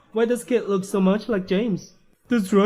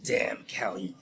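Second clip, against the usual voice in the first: low, hushed curse; cursing under breath; 11 semitones lower, 9 dB quieter than usual. Acted. Scheming father curses his absent daughter, under his breath. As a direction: swear quietly, almost whispered.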